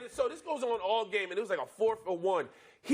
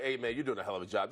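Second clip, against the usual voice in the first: low, fast buttering-up; mock flattery; 8 semitones lower, faster than usual. Opponent flattering him at the line, low and fast. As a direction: sweet-talk him low and quick. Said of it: "And then the first, like".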